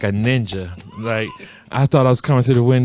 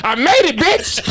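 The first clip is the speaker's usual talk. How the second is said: triumphant mock crowing; 13 semitones higher, slower than usual. Drunk teen boasting she beat curfew, triumphant. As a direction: crow it like a cheeky victory.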